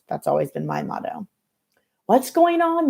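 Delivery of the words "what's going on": high, loud call up the stairs; shouting. A narrator's reading. Ms. Reba shouts up the stairs at the fighting girls. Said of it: "Ms. Reba screams".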